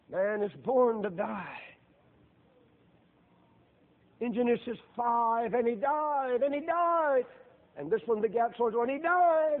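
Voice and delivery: loud, high, drawn-out preaching cry; full-force preaching. Acted. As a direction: preach it hard, climbing and stretching each phrase.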